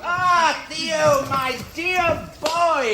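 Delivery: delighted greeting. Acted. Greets arriving son.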